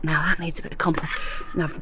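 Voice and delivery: whispered to herself; whispering. Real, not acted. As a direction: murmur it under your breath. Murmured aside while planting.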